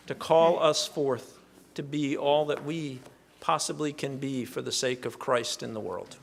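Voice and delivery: ordinary talk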